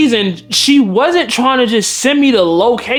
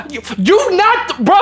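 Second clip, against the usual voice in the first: high, stretched-out splutter breaking into laughter; incredulous exasperation; 7 semitones higher than usual. Real, not acted. Reacting to her stalking worry, incredulous and half laughing. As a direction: splutter in disbelief, voice shooting up.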